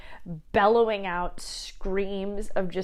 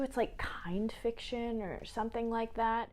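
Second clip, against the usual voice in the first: whisper; 2 semitones higher, 8 dB quieter than usual. Real, not acted. Unvoiced aside.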